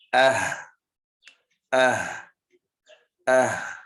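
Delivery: Ah said with a clear glottal stop then a breathy h, hamza with sukun ha; pron demo. Shown as a demonstration. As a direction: model Ah slowly, both letters audible. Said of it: "Watch me. Observe my pronunciation".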